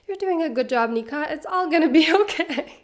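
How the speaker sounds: high, gentle pep-talk voice to herself, laughing at the end; soothing self-talk. Retold or imitated. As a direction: coo encouragement at yourself like a kind friend. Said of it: "It's a little silly".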